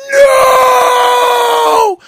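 high, loud wailing no; wife screaming as the house burns; imitated anguished scream. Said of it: "like, screaming".

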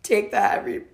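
fighting back real tears while talking; apologizing through real tears; crying. Real, not acted. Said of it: "I'm gonna compose myself".

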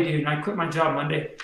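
ordinary talk, nothing marked

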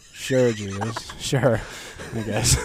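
slow, dry, unconvinced; sarcastic. Acted. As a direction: drag it out sarcastically. Sarcastic dismissal.

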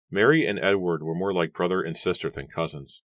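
ordinary delivery

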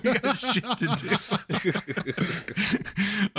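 laughing. Pretend. Mock demand, laughing.

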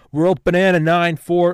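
sudden loud, high jumbled outburst; mock stroke babble. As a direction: blurt garbled words loud and high. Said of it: "I'm just having a stroke here".

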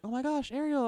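put-on high starstruck little-kid voice; excited kid. Kids meeting Ariel after the show, high gushing. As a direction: gush like a child meeting a princess. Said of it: "people come up to them".

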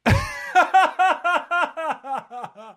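loud, high-pitched laugh; high burst of laughter. Real, not acted. Host cracking up at the guest's [name] joke, laughing hard.

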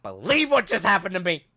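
loud, hammed-up victim reporting harassment; mock outraged complaint. Customer complaining to the manager, mock outrage. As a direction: protest loudly with mock indignation. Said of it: "go to the manager and be like".

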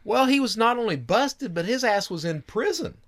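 high put-on voice for the informant; high-pitched retold informant; informant's voice. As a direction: voice the tipster up high.

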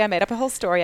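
ordinary talk, nothing marked

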